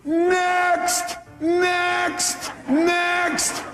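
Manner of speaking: long, high-pitched call; demonstrated yell. Shown as a demonstration. Counselor showing caller what to yell outside, high drawn-out holler. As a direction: holler each word high and stretched out. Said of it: "yell two words at the top of your lungs".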